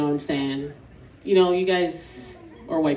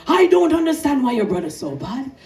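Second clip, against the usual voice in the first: put-on baffled Jamaican-accented dad, raised voice; higher, louder Jamaican dad voice; Jamaican father; 4 semitones higher, 5 dB louder than usual. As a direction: complain loudly in a Jamaican accent. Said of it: "He'd be like".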